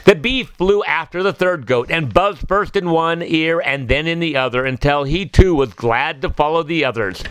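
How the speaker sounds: ordinary delivery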